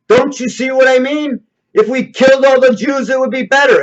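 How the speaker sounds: a hint of a ranting dictator; imitating Hitler ranting. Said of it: "he would say".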